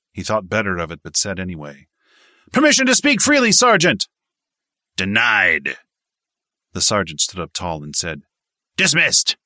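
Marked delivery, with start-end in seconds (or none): 2.5-4.1 very high, loud, fast shout; shouted request. A narrator's reading. Recruit asking his sergeant to speak, fast shout. 5.0-5.8 curt refusal. A narrator's reading. Sergeant curtly refuses. 8.8-9.4 higher, louder barked dismissal. A narrator's reading. Sergeant sending Cooper away, barked dismissal.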